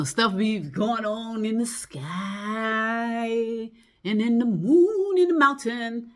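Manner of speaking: slow, high dreamy wonder; mysterious musing. Real, not acted. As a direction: draw it out like something mystical.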